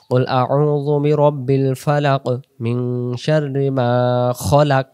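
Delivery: slow recited "খলাক" with short kho vowel; correct recitation. Shown as a demonstration. Teacher reciting Surah Falaq correctly, slow and careful. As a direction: recite slowly, "খলাক" clipped short not khalak. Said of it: "সেখানে খলাক হবে".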